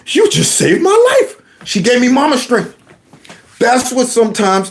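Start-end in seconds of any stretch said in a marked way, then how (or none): 0.1-1.3 high-pitched acted gratitude; overjoyed relief. Boy thanks mom after the rescue, high hammed-up relief. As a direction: let it leap up high with mock amazement.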